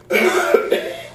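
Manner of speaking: high spluttering engine noise; sputtering bus noise. Retold or imitated. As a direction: imitate a bus coughing out. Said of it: "the bus literally goes".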